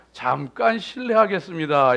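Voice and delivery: ordinary talk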